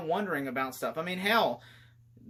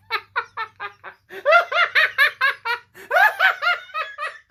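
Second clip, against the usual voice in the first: loud high laugh; 8 dB louder than usual. Real, not acted. Laughing at movie.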